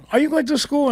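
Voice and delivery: high, pointed mock mom voice; a mom catching her son skipping class, pitched high; mom's pointed question. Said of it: "she go".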